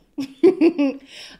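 loud burst of laughter. Real, not acted. Laugh after quote.

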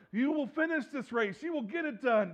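high, loud rallying cry; cheering encouragement. Retold or imitated. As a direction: shout belief into them.

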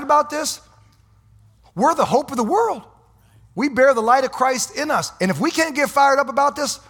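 high, loud rallying cry; fired-up preaching. Real, not acted. Preacher firing up the congregation.

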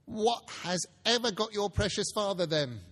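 a mother asking where everyone is; Mrs Cratchit's voice. Acted. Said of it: "said Missus Cratchit".

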